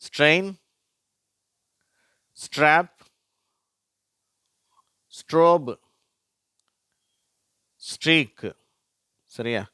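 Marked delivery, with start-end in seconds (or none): 0.0-8.5 higher, louder isolated words; model word reading. Shown as a demonstration. Teacher showing the blend in words, model pronunciation. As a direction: announce ஸ்ட்ரெயின் to ஸ்ட்ரீக் one at a time. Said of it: "வார்த்தைகளை பார்க்கலாம்".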